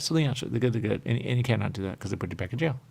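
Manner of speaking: ordinary delivery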